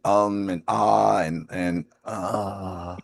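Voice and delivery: drawn-out filler sounds trailing away; mock hesitation. Showing listeners how they overuse filler words, mock hesitation. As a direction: imitate a speaker stalling on fillers. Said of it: "realizing that you are saying".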